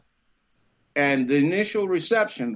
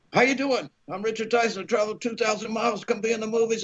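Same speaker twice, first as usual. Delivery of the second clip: high, rushed self-introduction; eager rote pitch; 5 semitones higher, faster than usual. Retold or imitated. Young actor trying the next office, eager and rushed. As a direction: race through the same introduction, pitched high.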